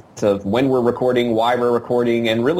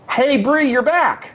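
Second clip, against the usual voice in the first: bright, much higher welcome; excited greeting; slower than usual. Real, not acted. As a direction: greet her with real delight. Greeting returning co-host.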